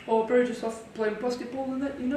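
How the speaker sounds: high, hammed-up New Zealand bloke; Kiwi accent. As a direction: play a laid-back Kiwi lad. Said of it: "do your Kiwi accent".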